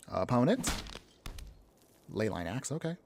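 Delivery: ordinary talk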